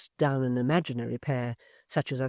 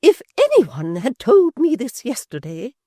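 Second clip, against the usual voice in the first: high, loud, slow grand pronouncement; stately high indignation; majesty; 11 semitones higher, 14 dB louder than usual. A narrator's reading. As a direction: speak like an offended queen. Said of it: "with majesty".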